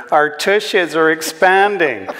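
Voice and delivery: put-on high, slow American kid voice; giggly American daughter. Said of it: "giggled Linda".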